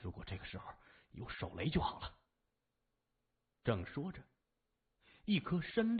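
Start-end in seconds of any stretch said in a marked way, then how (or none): 0.0-2.2 under-the-breath grumble, much softer; a soft, half-whispered wish; mumbling. A narrator's reading. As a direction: mutter it to himself, barely voiced. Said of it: "他嘟囔着".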